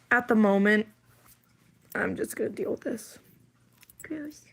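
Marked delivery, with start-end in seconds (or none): 4.0-4.4 high, soft whispered "gross"; whispered disgust. Real, not acted. Finding her own hair, whispered disgust. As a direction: whisper it with a grimace.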